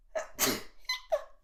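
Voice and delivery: a real sudden sneeze. Real, not acted. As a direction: let a sneeze burst out. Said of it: "I think I'm gonna sneeze".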